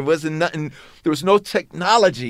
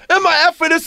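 high mock yell; imitating a scream; 11 semitones higher, 3 dB louder than usual. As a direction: squeal it like a distant tantrum. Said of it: "Russell in the background screaming".

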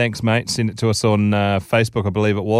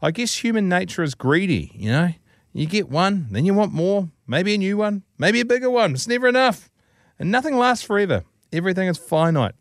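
pitched up, pondering each word; musing aloud like a humbled man; man speaking slowly; 7 semitones higher than usual. A narrator's reading. Joke's cheated husband answers the wise monk, deliberate and pitched up. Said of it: "then speaks slowly".

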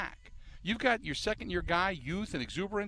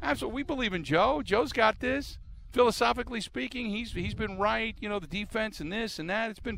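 higher, overeager "absolutely" pitch; mock eager endorsement; 7 semitones higher, 3 dB louder than usual. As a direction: gush like a yes-man GM. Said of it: "He didn't say".

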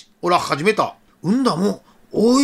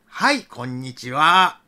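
low, slow, kindly hello; gentle voice; 5 semitones lower, slower than usual. Acted. Officer answering children over car speaker, gentle voice. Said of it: "優しか声ん".

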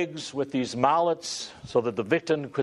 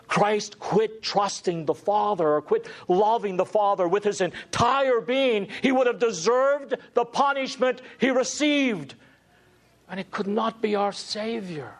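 impassioned preaching; 7 semitones higher than usual. Acted. Preaching climax, stakes.

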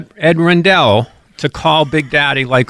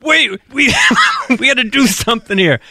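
higher pitched mock yell with a laugh breaking in; retold yell; 8 semitones higher than usual. A man yelling to his wife about a gig, mock yell. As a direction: shout off to the side in a hammed-up panic. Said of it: "you could hear him yell to".

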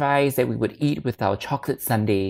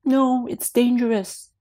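put-on slow, much higher little boy; scared child voice; 12 semitones higher than usual. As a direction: voice a frightened small boy. Son answering father.

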